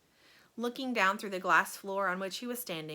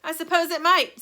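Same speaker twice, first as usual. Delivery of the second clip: loud, bright and breezy; cheerful; 8 semitones higher, 11 dB louder than usual. A narrator's reading. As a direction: brush it off cheerily. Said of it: "cheerful as ever".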